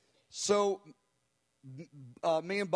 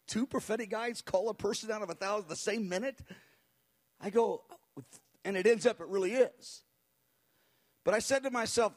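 normal talking voice

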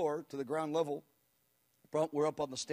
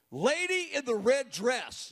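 slow, raised call across a big room; 6 semitones higher, 4 dB louder, slower than usual. Retold or imitated. Preacher calling out to the lady in the red dress, slow and raised. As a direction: pick one woman out of the crowd.

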